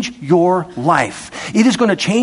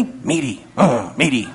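savoring meaty; 7 semitones lower, 4 dB quieter than usual. Pretend.

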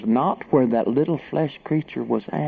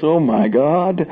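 higher mock yell; drawn-out, raised mock exclamation; imitated yell; 6 semitones higher, 4 dB louder, slower than usual. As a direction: mimic a woman's shocked cry. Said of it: "She yells".

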